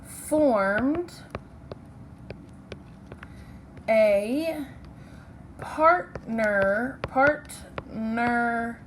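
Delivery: slow, word by word, with long gaps; slow dictation. Shown as a demonstration. Teacher dictating a sentence slowly to her class. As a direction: dictate each word slowly so they can write it down. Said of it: "the sentence for partnership that we're gonna write is".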